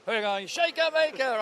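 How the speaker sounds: high, excited call from the stands; cheering the horse home. Retold or imitated. Mate in the stands cheering on Shaker Maker, high excited call.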